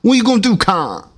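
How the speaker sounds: high mocking British squawk; mock British accent. Repeats the rapper's line mockingly.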